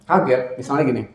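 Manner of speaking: normal talking voice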